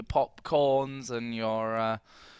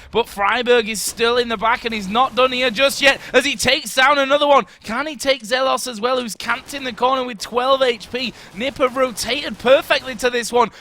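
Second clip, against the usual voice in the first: raised, driving esports call; play-by-play commentary; 8 semitones higher, 7 dB louder than usual. Acted. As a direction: call the action live. Live play-by-play.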